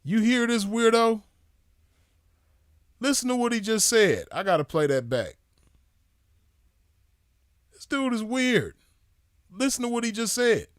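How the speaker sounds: outraged disbelief at a weirdo; incredulous. Real, not acted. Streamer reacting to Boom's clip in disbelief. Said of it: "Who talks like this".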